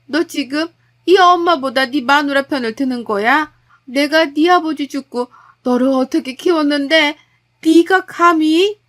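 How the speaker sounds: betrayed mother lashing at her son; outraged mother-in-law. Acted.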